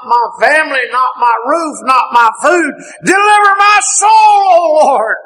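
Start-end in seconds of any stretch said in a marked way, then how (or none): 3.0-5.2 preacher crying out the psalm; fervent cry. Real, not acted. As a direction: hurl the plea upward. Psalm quoted with fervour.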